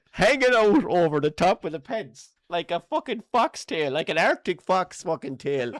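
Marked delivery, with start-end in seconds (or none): none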